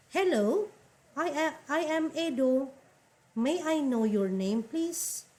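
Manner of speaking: high, bright model reading of a dialogue line; teacher voicing a character in a textbook dialogue; reading a dialogue role. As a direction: read the man's line as a class example. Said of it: "the dialogue is between two men".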